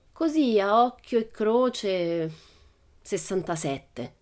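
slow, pondering guess; after careful thought. Acted. Pippi guessing a sum for the teacher, slow and pondering. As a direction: weigh it up before answering. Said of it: "dopo matura riflessione".

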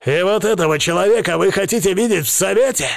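high, fast indignant outburst; angry bark. A narrator's reading. Krestovsky challenges the heads of the families, angry bark. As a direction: bark it at the whole room. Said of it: "рявкает, обводя взглядом".

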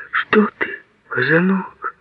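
high, gentle half-whisper; tender whisper. A narrator's reading. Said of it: "нежно прошептала она".

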